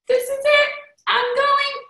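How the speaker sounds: thrilled and disbelieving; hopeful excitement. Retold or imitated. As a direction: leap up in hope. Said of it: "And I'm like".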